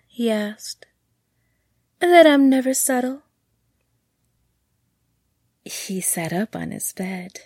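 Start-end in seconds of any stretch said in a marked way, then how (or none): 2.0-3.2 higher, louder tease; quick cheeky comeback; playful. A narrator's reading.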